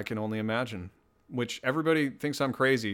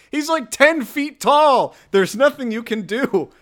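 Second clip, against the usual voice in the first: high, loud, drawn-out exclamation; hammed-up cry of hopeless warning; mock panicked shout; 13 semitones higher, 10 dB louder, slower than usual. Warning listeners about ten-foot-tall James, high mock-panicked shout.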